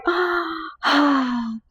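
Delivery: long, drawn-out gasp of dismay; mock shocked gasp. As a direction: gasp in exasperated disbelief, retelling it. Reenacting shock at broken screen.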